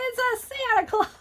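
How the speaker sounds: high, amused reveal then a laugh; laughing at herself. Real, not acted. Grandma laughs at her own Santa hat joke, high and giddy. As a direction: crack yourself up. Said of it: "I crack myself up".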